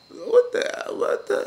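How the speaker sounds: high squeaky disbelief; mock shock. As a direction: squeak it out as if stunned. Wife's shock, acted out.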